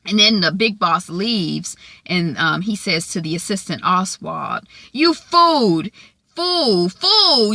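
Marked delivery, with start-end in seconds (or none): 4.9-7.5 high, loud repeated scolding; mock furious. Cunliffe berating his assistant, hammed-up angry yell. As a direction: spit out each fool in mock rage.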